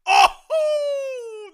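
high, loud gasping oh; real surprise yell; shocked shout. Real, not acted. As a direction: jolt out a startled cry. Reacting to a low blow.

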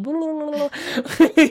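high, loud mock chatter; hammed-up blah blah of the inner critic. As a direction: rattle it off like a nagging inner voice. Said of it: "And you're like".

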